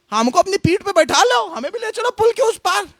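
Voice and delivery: lightly voiced mouse, pitched high; pleading mouse. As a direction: ask for a ride in a high voice. Mouse pleads with elephant in fable. (हल्की ऊँची आवाज़ में चूहे की विनती)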